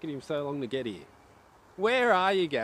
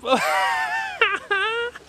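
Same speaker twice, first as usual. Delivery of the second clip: shrill burst of laughter, much higher; high shrieking laugh; 7 dB louder than usual. Real, not acted. Streamer caught out by chat, shrieking laugh.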